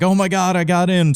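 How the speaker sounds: high, squealing fake joy; mock excited gasp. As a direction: gush like you just got great news. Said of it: "being like".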